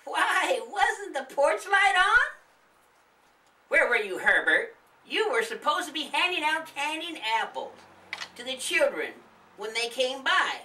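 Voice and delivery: high, nagging wife's voice; scolding old woman. Acted. The old woman scolding her husband about the candy, high nagging. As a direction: tell off your lazy husband.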